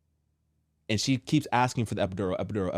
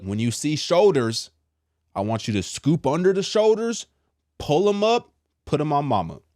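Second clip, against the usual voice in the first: high, loud step-by-step instructions; instructing doctor; 6 semitones higher, 4 dB louder than usual. Retold or imitated. Doctor coaching dad to catch baby, urgent instructing. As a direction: call out each step, pitch climbing.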